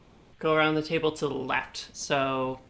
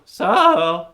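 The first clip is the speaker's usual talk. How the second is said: a sudden loud crack upward on one word; 3 semitones higher, 12 dB louder than usual. Real, not acted. Player trying to start their turn, voice crack. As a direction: voice cracking loudly. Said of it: "sorry, voice crack".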